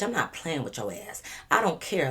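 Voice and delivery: ordinary talk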